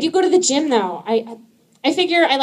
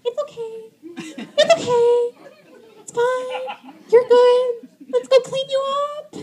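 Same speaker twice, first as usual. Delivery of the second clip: high, sing-song reassurance; calm the sick kid sweetly; high singsong voice comforting a sick child; soothing mom voice; 14 semitones higher than usual. Retold or imitated.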